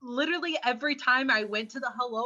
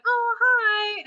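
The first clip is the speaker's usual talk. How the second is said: high, sing-song hello to a small animal; cutesy greeting; 13 semitones higher, 6 dB louder than usual. Retold or imitated. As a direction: coo at the squirrel, pitched way up. Greeting a squirrel on walk.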